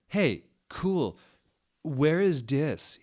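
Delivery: high, slow emo drawl. Acted. Satan asking where they are in emo voice. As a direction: ask it like a moody emo boy. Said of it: "he asked in an emo voice".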